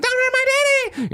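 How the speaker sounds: put-on child pleading. Danny begs Sarah to spare his dad, high child's plea. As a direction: beg like a small boy. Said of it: "Danny runs in".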